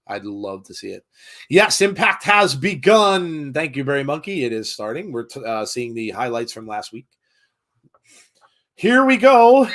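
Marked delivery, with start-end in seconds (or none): 1.5-3.5 excited announcement. Real, not acted. Show starts on TV. 8.7-9.7 high, loud and thrilled, pitched well up; excited. Real, not acted. Wrestling show starts.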